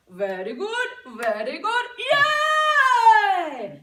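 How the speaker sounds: put-on loud cheer in an Indian accent, ending in a high sung yay. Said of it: "I like to use his accent".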